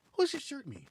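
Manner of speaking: imitating puzzled man. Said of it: "He goes".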